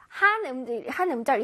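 ordinary delivery

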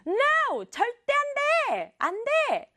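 very high-pitched furious no; mock angry refusal. Teacher acts the angry sister refusing her brother, mock angry refusal. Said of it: "지금 화가 너무 나가지고".

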